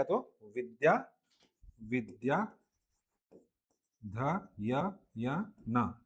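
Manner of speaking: syllables spaced apart slowly; split into parts. Shown as a demonstration. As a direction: break Vidya and ध य य न into separate slow pieces. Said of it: "तो मैं जो बोला था विद्याध्ययन".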